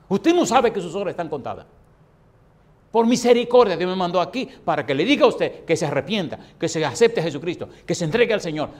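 advertencia urgente a gritos, tono muy alto; amonestación severa. Acted. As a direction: predica a todo pulmón contra el pecador. Sigue amonestando al moribundo. (reprensión vehemente a gritos)

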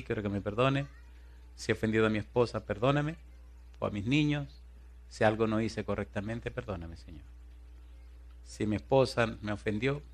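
soft home prayer to the Lord. Retold or imitated. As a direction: pray humbly with family. Ora en casa. (oración suave en familia)

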